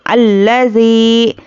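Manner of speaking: slow chanted tilawat; Quran recitation. Shown as a demonstration. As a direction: recite slowly with long vowels. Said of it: "teacher recites". (धीमी तिलावत)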